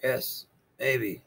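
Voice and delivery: a hint of a calm old turtle. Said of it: "said the turtle".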